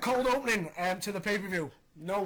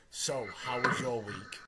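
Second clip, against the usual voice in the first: low, slow cheesy delivery; mocking impression, pitched down and drawn out; corny mock line; 8 semitones lower, slower than usual. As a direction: deliver it slowly as mock small talk. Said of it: "a corny, cheesy angle".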